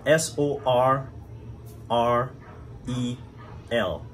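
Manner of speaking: letters S O R R E L said slowly one by one; spelling it out. Shown as a demonstration. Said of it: "I don't know how you would pronounce it".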